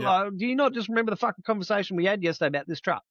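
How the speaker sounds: high and fast, rattled off in a put-on voice; fast, high-pitched mocking of the forgetful boss; mock-incredulous boss. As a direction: rush it out high, playing the boss.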